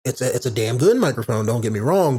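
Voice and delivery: ordinary delivery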